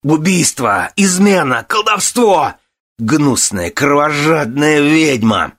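very high, drawn-out screamed accusations; enraged shouting. A narrator's reading. Son raging at the lady over dead mother, screaming. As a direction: shout each charge, choking with rage. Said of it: "захлёбываясь словами, заорал".

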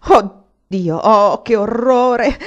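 loud, drawn-out cry of horror; horrified cry. A narrator's reading. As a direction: wail it out slowly, aghast. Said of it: "Egli gridò due volte".

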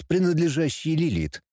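usual speaking voice

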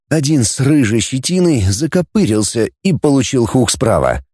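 plain reading voice

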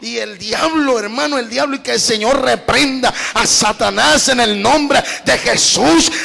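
fiery preaching. Real, not acted. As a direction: rebuke Satan at full force. Predicación a todo pulmón. (predicación gritada contra el diablo)

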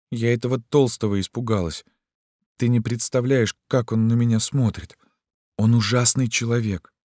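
put-on high, girlish confession; frightened girl. A narrator's reading. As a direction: confide fear in a small high voice. Girl figure confiding fear.

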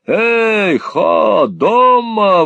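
high, loud shouted hail; loud call. A narrator's reading. Lenville hailing Nicholas through the door, loud shout. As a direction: holler it up the stairs. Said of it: "восклицал басом мистер Ленвилл".

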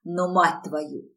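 ordinary delivery, nothing marked

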